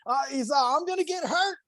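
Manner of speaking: high, hammed-up pleading; mock plea for help. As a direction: beg to be lifted out. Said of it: "He goes".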